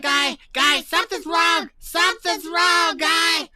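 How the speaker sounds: high, loud panicked shouting; acted alarm. Ship warns the player that something's wrong, alarmed high shout.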